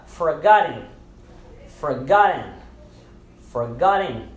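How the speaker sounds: "forgotten" with the TT not crisply sounded, ending in "in"; silent T, IN ending. Shown as a demonstration. Teacher models "forgotten" for learners, T silenced. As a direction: end "forgotten" in "-in", no hard T. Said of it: "palavras em que nós silenciamos".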